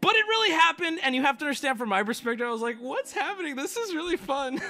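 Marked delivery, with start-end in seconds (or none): 2.8-4.6 high, softish, giddy aside with a laugh; mock giddy reaction. Tattoo client recalling being thrilled in the chair, giddy. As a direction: squeal it lightly, laughing at yourself. Retelling own excited reaction.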